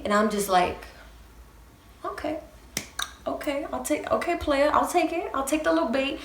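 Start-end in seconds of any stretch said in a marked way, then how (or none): 2.0-6.2 sarcastic playing along. Mock-playing along with cocky guy.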